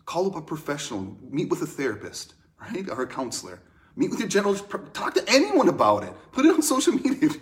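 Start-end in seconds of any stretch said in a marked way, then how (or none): 4.0-7.3 higher and louder, rising to a near-squeal; playful, mounting excitement; building exuberance. Real, not acted. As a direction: escalate comically to a loud, high-pitched urging. Urges hearers, escalating to absurd.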